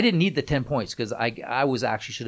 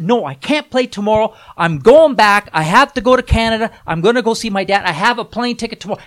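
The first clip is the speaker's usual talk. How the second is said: high, loud fake excuse; 9 semitones higher, 10 dB louder than usual. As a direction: insist loudly on the trip. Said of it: "I said".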